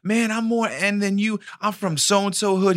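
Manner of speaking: mocking high braggart; mock wannabe thug. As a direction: brag like a wannabe gangster.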